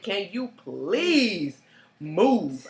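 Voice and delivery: slow, drawn-out "please", pause before "move"; exasperated request. Retold or imitated. Asking the date to sit further away, slowly and pointedly. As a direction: stretch "please", then set "move" apart. Retold request, he sits close.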